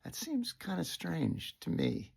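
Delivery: whispered and slow, stumbling; a halting, breathy whisper; hesitant whisper. Retold or imitated. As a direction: falter it out under your breath. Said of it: "And I said".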